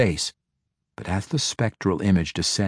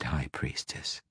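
mocking title; 8 semitones lower, 14 dB quieter than usual. A narrator's reading. Girl belittles Quenthel with her old title, soft mocking. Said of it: "Go ahead".

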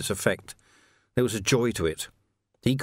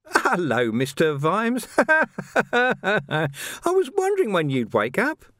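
higher, sly, with a giggle; leering villain voice; 10 semitones higher, 3 dB louder, slower than usual. Acted. Carcer greeting Vimes from the next cell, sly and giggling. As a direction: oily menace with a laugh.

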